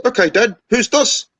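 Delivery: very high-pitched son voice; acted cocky high-pitched question; challenging son. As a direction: quiz your dad in a high kid's voice. Son challenges dad in joke.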